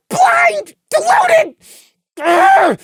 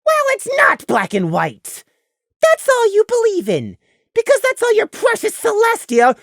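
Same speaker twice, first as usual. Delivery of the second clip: usual speaking voice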